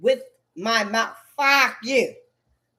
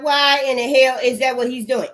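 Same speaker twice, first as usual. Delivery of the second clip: normal talking voice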